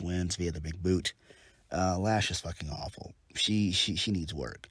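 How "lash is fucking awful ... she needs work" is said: ordinary talk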